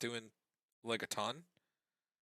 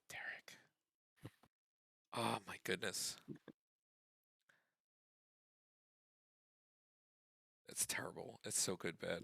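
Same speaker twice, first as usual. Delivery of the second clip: disappointed groan; 7 semitones lower, 14 dB quieter than usual. Real, not acted. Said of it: "in that tone of voice".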